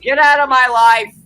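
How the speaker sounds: loud, high shouted line; dramatic yell. Acted. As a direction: belt it like a soap diva. Repeats dramatic line louder.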